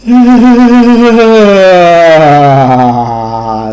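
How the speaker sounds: loud, drawn-out groan sliding down in pitch; long uneasy groan. Real, not acted. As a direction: let out a long falling noise of discomfort. Unsettled reaction to the grim fable.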